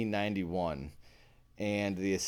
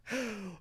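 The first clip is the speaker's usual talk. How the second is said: high giggle; 14 semitones higher, 5 dB quieter than usual. Real, not acted. Laughing fit.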